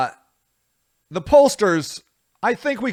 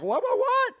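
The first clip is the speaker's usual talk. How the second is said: much higher, stunned, retold "what, what, what"; stunned disbelief; 4 dB quieter than usual. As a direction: squeak it out in mock shock at the news. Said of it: "I'm like".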